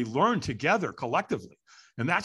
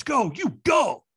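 high, drawn-out urging; urgent command; 9 semitones higher, 4 dB louder, slower than usual. Retold or imitated. Man urging her onward into the night, urgent command. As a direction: send them off with a rising push.

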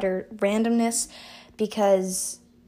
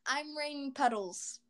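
awkward intro; 2 semitones higher, 9 dB quieter, slower than usual. Real, not acted. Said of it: "I've never introduced myself like that".

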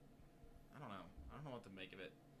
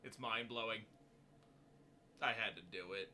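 put-on Kennedy Boston accent. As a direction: answer like President Kennedy. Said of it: "Mister Kennedy".

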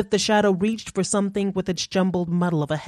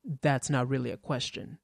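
dry remark; 4 semitones lower, 8 dB quieter than usual. A narrator's reading.

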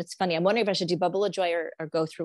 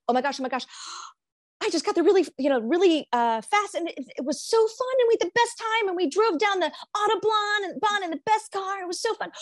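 high, gushing thrill; giddy, breathless enthusiasm; acted excitement; 9 semitones higher than usual. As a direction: rattle on as if remembering the best time. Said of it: "Or you could say".

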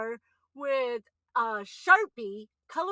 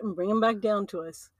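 ordinary talk, nothing marked

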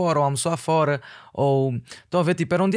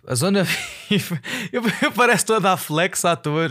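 laughing; 4 semitones higher than usual. Real, not acted. Embarrassed about VIP bragging.

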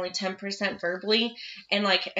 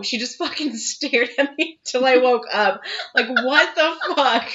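usual speaking voice

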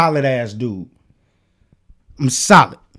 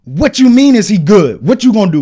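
louder, higher mock challenge; confrontational; 5 semitones higher, 5 dB louder than usual. Imitating homie questioning if he's good, confrontational. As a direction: mimic a man squaring up, voice raised. Retold challenge to other man.

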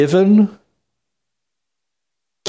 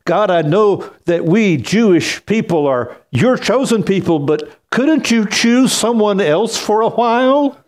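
lightly voiced pleading Tevye, higher; a hint of Tevye begging God, pitched up; imitating Tevye; 7 semitones higher than usual. Quoting Tevye's plea to God, mock pleading, pitched higher. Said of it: "Rav Tavian says in the musical".

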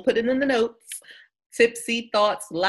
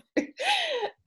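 high laugh; 5 dB quieter than usual. Real, not acted. Laughing at own joke.

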